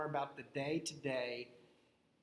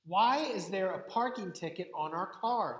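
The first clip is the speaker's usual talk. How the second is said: higher, louder mock yell with a leap on "Why"; retold wife's yell; 4 semitones higher, 5 dB louder than usual. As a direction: imitate an exasperated wife calling out. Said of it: "when the wife yells out".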